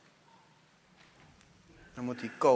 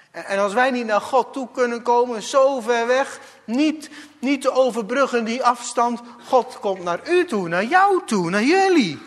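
rising higher and louder to a cry; building preaching; 8 semitones higher, 8 dB louder than usual. Acted. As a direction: build from urgent to a full cry. (steeds luider preken)

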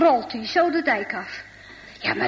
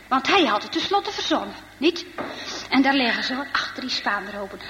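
ordinary delivery

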